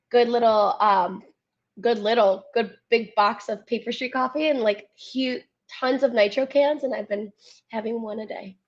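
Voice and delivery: ordinary talk, nothing marked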